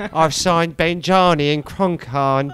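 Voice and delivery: very slow, rambling list; imitating Harry Redknapp. Manager boasting of signings to the reporter, very slow. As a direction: savour each name like an old manager. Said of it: "I love Cockney storytellers".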